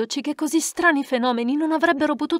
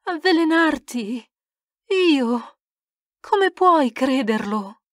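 pleading protest; 3 semitones higher, 4 dB louder, slower than usual. Acted. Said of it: "la poverina protesta".

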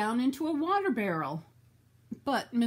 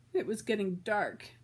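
ordinary delivery

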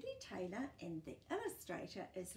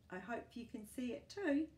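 ordinary delivery

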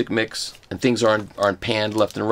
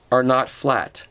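usual speaking voice

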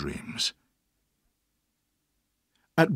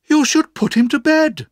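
much higher, louder character voice; high, emphatic suggestion; Mister Dick's advice; 10 dB louder than usual. A narrator's reading. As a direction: pipe up high and loud with simple advice. Decisive advice voiced in story.

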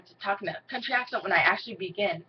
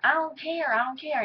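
put-on bratty kid voice; 7 semitones higher than usual. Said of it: "he was like".

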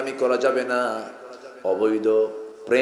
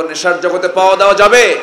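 raises the question much louder; 5 semitones higher, 10 dB louder than usual. Shown as a demonstration. Preacher repeats the question louder to the youth. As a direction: hit the crowd louder. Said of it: "আসতে বলবো".

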